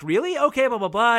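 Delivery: high, eager voice; a bright, thrilled young girl; excited. Retold or imitated. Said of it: "like, excited and ecstatic".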